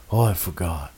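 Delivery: low, quiet, dopey "oh I forgot"; low quiet sheepish excuse; sheepish mumble. Retold or imitated. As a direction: shrug it off like a guilty kid. Teller voices Jake's lame excuse.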